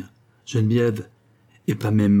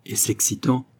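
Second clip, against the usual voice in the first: usual speaking voice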